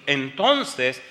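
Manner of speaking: ordinary talk, nothing marked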